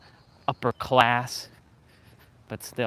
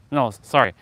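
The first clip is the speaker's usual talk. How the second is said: ordinary delivery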